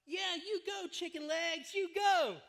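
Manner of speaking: loud, high jeering shout; high taunting holler, building to the last word; called-out taunt. Retold or imitated. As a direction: yell it after someone running away. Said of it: "so I called out".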